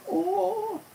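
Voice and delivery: high surprised oh. Real, not acted. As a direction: yelp it out in dismay. Train stalls.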